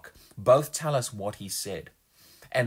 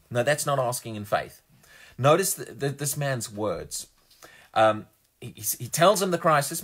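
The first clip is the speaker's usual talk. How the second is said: normal talking voice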